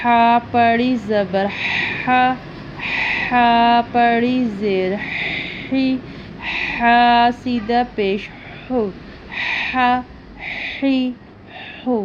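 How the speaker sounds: ح said with a rasping throat breath; throaty ha. Shown as a demonstration. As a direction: push ح out from the throat. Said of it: "یہ حلق سے ادا کیا جاتا ہے".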